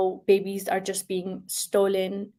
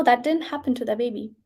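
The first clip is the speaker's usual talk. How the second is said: ordinary delivery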